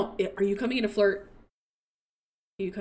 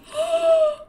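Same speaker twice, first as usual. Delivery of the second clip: high, sharp gasp of delight; 5 dB louder than usual. Real, not acted. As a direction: gasp at an adorable sight. Sees the aged-up baby.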